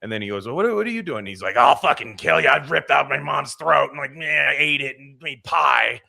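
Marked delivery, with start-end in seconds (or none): 0.5-1.2 high, stammered question; bewildered newcomer. Acted. Newcomer questions the next man. 1.6-6.0 loud, raised-pitch maniac rant; hammed-up crazed killer, loud and unhinged; out-of-control psycho. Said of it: "like, got, like, psycho".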